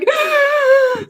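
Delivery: high sharp gasp of shock; imitating her seven-year-old self gasping; mock gasp of heartbreak. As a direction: gasp like a kid whose hero dies. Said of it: "seven-year-old me was just like".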